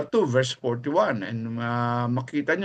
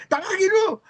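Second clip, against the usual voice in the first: very high, sharp command from the wife; wife's order; 3 dB louder than usual. Retold or imitated. The boss's wife ordering the waiter to fetch her husband, pitched very high. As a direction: bark it as the annoyed wife.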